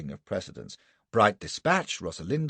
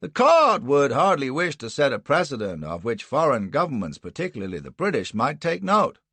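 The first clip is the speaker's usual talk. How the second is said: much higher, louder cut-in; Anderson interrupting; 8 semitones higher, 6 dB louder than usual. Acted. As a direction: leap in over the other lawyer. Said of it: "Anderson added quickly".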